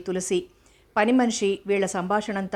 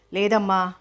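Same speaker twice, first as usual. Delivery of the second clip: ordinary delivery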